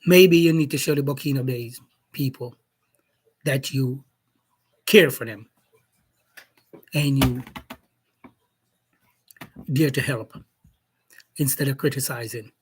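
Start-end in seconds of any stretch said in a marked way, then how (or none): none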